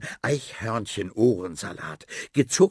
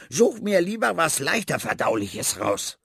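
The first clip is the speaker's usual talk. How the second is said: rat voice; 7 semitones higher, 4 dB louder than usual. Acted. Rat ordering Finn.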